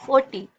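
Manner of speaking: "Forty" said loudly, meant to focus on टी; stress on syllable. Shown as a demonstration. Teacher showing where focus goes, stressed टी in "forty". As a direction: mark out टी in "forty". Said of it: "टी पे आपका ज्यादा focus".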